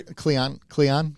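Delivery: KLINGON with the G swallowed, "klee-on"; mispronounced Klingon. Shown as a demonstration. Explaining the habit to a cohost, KLINGON as "klee-on". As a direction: drop the NG in KLINGON, saying "KLEE-on". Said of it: "because I pronounce every".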